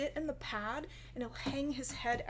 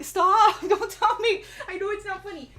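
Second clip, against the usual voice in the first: laughing protest; 7 semitones higher, 10 dB louder than usual. Real, not acted. Laughing at chat comments.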